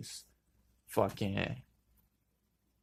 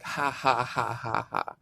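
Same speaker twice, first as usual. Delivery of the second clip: acting out helpless laughter; mock belly laugh, slightly raised pitch; imitated laughter; slower than usual. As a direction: mimic laughing your ass off. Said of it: "I'm dying laughing. ... But not sarcastically".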